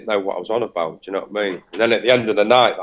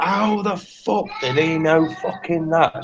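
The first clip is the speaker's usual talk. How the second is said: higher, slow, drawn-out disbelief; incredulous amazement; 5 semitones higher, slower than usual. Retold or imitated. Woman asking her sister about the psychic, incredulous amazement. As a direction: stretch out the question in retold astonishment. Retelling sitter's amazed outburst in toilet.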